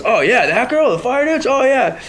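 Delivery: loud, high-pitched recognition; imitated high, excited approval; enthusiastic stranger. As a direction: mimic an eager guy catching on. Acting out a stranger's keen reply.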